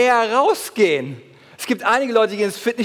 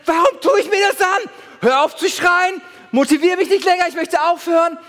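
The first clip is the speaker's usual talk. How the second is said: exasperated mock shout; 8 semitones higher than usual. Preacher acting his inner gym protest, mock shout. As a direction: cry out as if about to quit. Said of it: "emotional und gedanklich".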